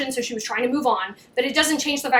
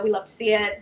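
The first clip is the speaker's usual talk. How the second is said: usual speaking voice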